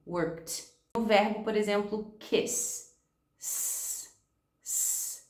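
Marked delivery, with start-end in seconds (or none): 0.1-0.6 "worked" ends in a crisp t, one syllable; teacher models "worked" as work-t. Shown as a demonstration. Teacher shows students the past tense. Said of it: "o T também não". 2.2-5.2 "kiss" and "s" hissed without voice; teacher models an unvoiced "s"; voiceless s. Shown as a demonstration. Teacher shows the final s of kiss. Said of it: "É um som que não vibra".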